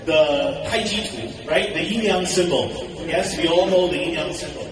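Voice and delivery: normal talking voice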